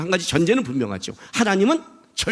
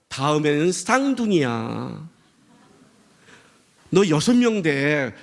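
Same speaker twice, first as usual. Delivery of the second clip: slow, drawn-out reveal; patient explanation; slower than usual. Acted. God explains the delay to the parent, patient and slow. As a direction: break the news unhurriedly, word by word.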